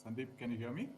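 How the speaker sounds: very faint, far from the mic; hardly heard over the call; barely audible. Real, not acted. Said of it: "Your voice is very low".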